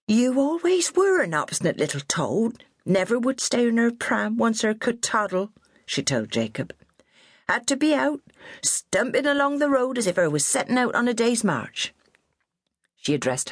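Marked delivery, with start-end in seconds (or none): 0.1-5.5 high, bossy West Country nanny voice; scolding old countrywoman. A narrator's reading. Old nurse scolding her fallen charge, high and bossy. Minnie scolds about childhood. 7.5-11.9 high, bossy West Country nanny voice; scolding old countrywoman. A narrator's reading. Old nurse scolding her fallen charge, high and bossy.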